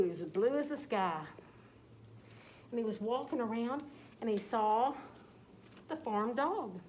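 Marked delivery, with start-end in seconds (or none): none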